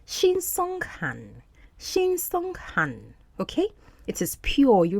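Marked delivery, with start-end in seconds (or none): 0.1-3.1 "Shinseonghan" slowly in three syllables, shin-song-han; "Shinseonghan" spaced out, high then falling; Korean word demonstrated. Shown as a demonstration. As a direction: model "Shinseonghan" syllable by syllable, twice. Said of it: "In Korean, this is called".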